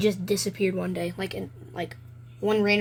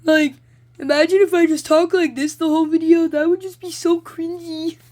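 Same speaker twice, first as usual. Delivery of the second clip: high, loud cartoon giraffe voice; squeaky character voice, much higher and louder; Jerry's voice; 10 semitones higher, 10 dB louder than usual. Shown as a demonstration. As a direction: pitch way up and push it out like a cartoon. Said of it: "It's so weird using Jerry's voice".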